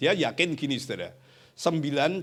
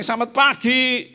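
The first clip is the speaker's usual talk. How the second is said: high, slow sung-out good morning; teacher's cheery greeting; 12 semitones higher, 11 dB louder, slower than usual. Retold or imitated. Teacher greets students who ignore him, high and slow. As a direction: call it out brightly to the class.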